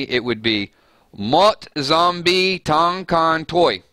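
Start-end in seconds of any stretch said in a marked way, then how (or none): 1.2-3.8 slow, higher, carefully sounded-out Vietnamese; drawn-out Vietnamese through a Southern accent; Vietnamese with a Southern twang. Shown as a demonstration. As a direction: read the Vietnamese line slowly with a Deep South accent. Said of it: "I'm gonna put a little Deep South flavor into some Google Translate".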